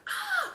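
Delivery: very high, loud shriek of a sudden waking; jolted awake and screaming back; startled scream. Retold or imitated. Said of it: "And then I scream".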